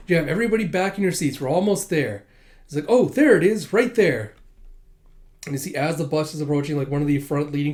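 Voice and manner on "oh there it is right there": higher, louder excited call; excited pointing. Acted. Kid on the bus spotting the museum, excited call. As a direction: point it out eagerly.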